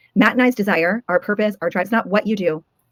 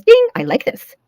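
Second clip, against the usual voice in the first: much higher, bright ding of a pleased brain; brain's voice; 13 semitones higher, 5 dB louder, slower than usual. Retold or imitated. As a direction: chime it like a happy bell. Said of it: "your brain goes".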